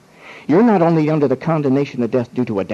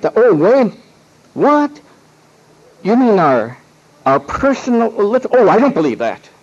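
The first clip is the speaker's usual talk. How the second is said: startled jump up in pitch on "what?"; mock alarm, pitched high and louder; shocked witness; 7 semitones higher, 5 dB louder than usual. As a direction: play the witness suddenly catching on, alarmed. Witness realizing personal sins.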